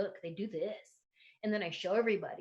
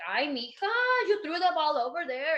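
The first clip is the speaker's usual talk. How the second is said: put-on Mexican accent parent; 7 semitones higher, 6 dB louder than usual. Comic doing her old joke as a Mexican-accented mom. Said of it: "they have an accent".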